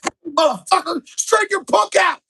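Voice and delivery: high, loud screaming fury; dad screaming at the rival coach; enraged yell. Retold or imitated. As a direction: scream across the mat at the other side. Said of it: "I hear my dad yelling".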